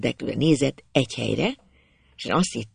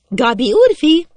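very high, loud call; girl calling up; 10 semitones higher, 6 dB louder than usual. A narrator's reading. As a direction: call out to the boy up in the tree. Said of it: "Felkiáltott".

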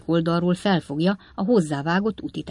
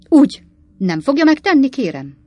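higher, louder taunting challenge; challenging tone; 8 semitones higher, 9 dB louder than usual. A narrator's reading. Slark taunting Pott, in a challenging tone. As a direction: throw the words back at him. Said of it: "mondta Slark úr kihívó hangon".

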